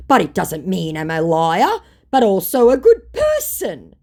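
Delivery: loud, high, pleading self-defence; mock his excuse with big emphasis; mock scammer. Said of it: "he tries to say".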